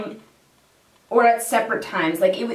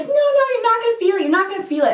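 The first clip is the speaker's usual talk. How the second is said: higher, fast mock-soothing doctor; reassuring injector; 11 semitones higher, much faster than usual. Injector calming a nervous client, mock soothing. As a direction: brush off her fears, quick and light.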